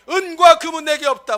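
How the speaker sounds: loud, proud declaration; bold proclamation. Acted. Preacher booming the apostle's boast to the congregation, loud.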